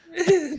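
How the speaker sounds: high, loud burst of laughter. Real, not acted. Co-host bursts out laughing at the story.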